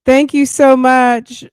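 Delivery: high, loud, slow gushing thanks; delighted thanks. Real, not acted. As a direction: beam it out to the chat. Thanks chat loudly, delighted.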